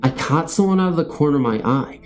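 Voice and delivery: usual speaking voice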